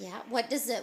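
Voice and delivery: normal talking voice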